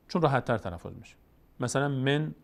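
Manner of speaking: ordinary talk, nothing marked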